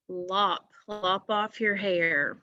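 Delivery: normal talking voice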